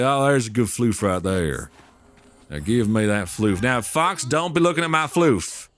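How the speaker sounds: playful southern accent. Shown as a demonstration. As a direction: lay on a folksy southern accent. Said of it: "I gotta do my southern accent".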